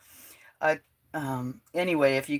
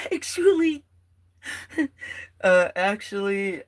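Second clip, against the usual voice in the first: laughing; 7 semitones higher, 8 dB louder than usual. Real, not acted. Laughing between tries at a word.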